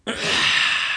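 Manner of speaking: long airy exhale imitating a soundless fart; silent fart noise. Shown as a demonstration. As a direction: let air hiss out with no voice. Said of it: "totally silent farts. Just the farts are just like".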